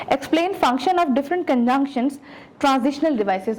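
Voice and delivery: slow, deliberate reading of the syllabus goals; formal objectives read-aloud. Acted. Teacher reading the unit objectives to her students, slow and formal.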